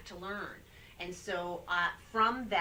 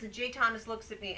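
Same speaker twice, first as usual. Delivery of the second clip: ordinary talk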